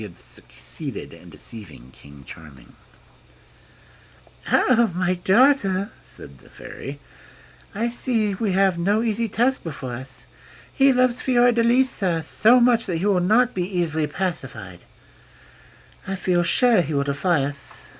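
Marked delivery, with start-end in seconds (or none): 4.5-5.9 high, slow fairy voice; a grave old fairy sighing; fairy godmother. A narrator's reading. Fairy speaks to goddaughter. 7.7-17.9 high, worried fairy voice; a fairy fretting over a hard task; fairy godmother. A narrator's reading. Fairy speaks to goddaughter.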